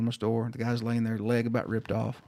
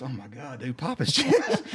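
retold exasperation. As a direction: imitate an exasperated medic, stretching the order. Medic losing patience, retold.

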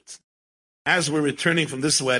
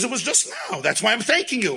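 quoted woman; 7 semitones higher than usual. Retold or imitated.

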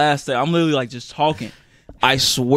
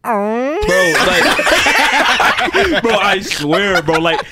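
high, loud words broken by laughter; real helpless giggling fit; laughing hard; 7 semitones higher, 5 dB louder, much slower than usual. Real, not acted. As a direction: try to talk while cracking up. Cracking up at own embarrassing story.

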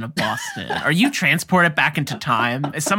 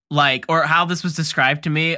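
ordinary talk, nothing marked

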